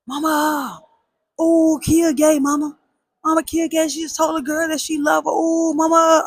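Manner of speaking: high, sing-song tattletale voice; kid tattling. Retold or imitated. Cousin telling mom about the girl, high teasing tattle. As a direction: squeal it to mom like a snitching kid.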